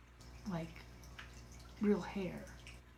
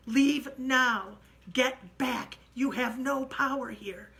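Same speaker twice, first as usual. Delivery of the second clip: loud, higher ordering voice; commanding banishment; 5 semitones higher, 10 dB louder, slower than usual. Retold or imitated. Narrator banishing the ghost with Jenny, loud command. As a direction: cast it out with full authority.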